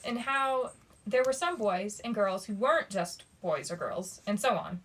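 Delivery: plain reading voice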